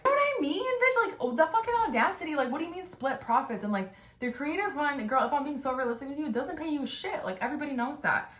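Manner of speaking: high-pitched, fast, indignant rant; outraged rant. Real, not acted. Outraged rant about his audacity.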